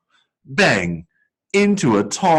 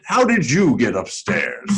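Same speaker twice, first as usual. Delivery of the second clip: 1 night watchman; 4 semitones higher than usual. A narrator's reading. Watchman voiced in story.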